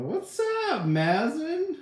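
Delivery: high, bright call of welcome; excited greeting. Real, not acted. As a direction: sing out the name to greet a friend. Greeting a late viewer by name.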